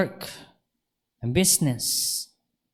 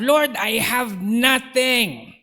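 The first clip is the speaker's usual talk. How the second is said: very slow, higher, hopeless plea; helpless plea; 7 semitones higher, much slower than usual. Retold or imitated. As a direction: drag it out like giving up. Retold prayer in despair. (mabagal at walang pag-asang daing)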